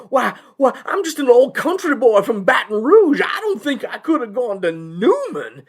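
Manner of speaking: high, loud flustered country-lawyer voice; panicking lawyer. Acted. Avondale's lawyer hears of Newman, flustered and panicking. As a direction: sputter a hammed-up panicky excuse. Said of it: "Avondale's lawyer's panicking".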